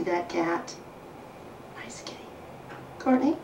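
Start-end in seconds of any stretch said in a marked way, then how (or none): 1.8-2.2 soft whisper. Real, not acted. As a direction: breathe it out quietly. Quiet aside after cat leaves.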